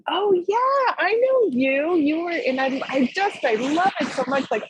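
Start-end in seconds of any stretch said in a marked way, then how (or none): 0.1-2.3 high, animated burst of recognition; delighted recognition. Acted. Practitioner greeting a fellow from the community, delighted recognition. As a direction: exclaim as if spotting a familiar friend.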